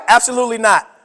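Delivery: ordinary talk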